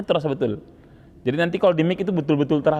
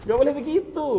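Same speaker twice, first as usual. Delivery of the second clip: amused scolding; 9 semitones higher than usual. Real, not acted. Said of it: "Mau bilang lidahnya ke samping".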